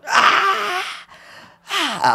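very high, strained scream; retold scream. Screaming in the pillar, high strained cry. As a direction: scream it high, held back in volume. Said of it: "just screaming".

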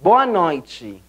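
BOA NOITE as "BOH-ah NOY-chee"; Portuguese pronunciation. Shown as a demonstration. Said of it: "en portugués sería".

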